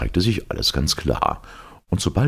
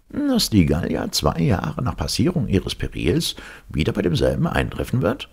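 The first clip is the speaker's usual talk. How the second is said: higher-pitched professor quizzing; dry lecturer's tone; 7 semitones higher than usual. A narrator's reading. Professor quizzing the captain, dry lecturing tone. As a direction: put it as a dry academic question. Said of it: "in trockenstem Dozententone".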